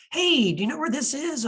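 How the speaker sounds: friendly hailing. Acted. Film fan acts out driver asking strangers, friendly hailing. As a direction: pull up and ask a stranger for directions.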